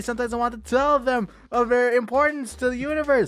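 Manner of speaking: heartfelt storyteller voice; earnest poem reading. A narrator's reading. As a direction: plead gently, like a caring spirit. Reads poem aloud dramatically.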